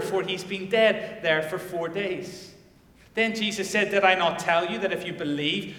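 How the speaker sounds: loud, ringing sermon reading of the miracle; preaching at full force. Acted. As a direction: proclaim it to the whole church. Preaches the Lazarus passage.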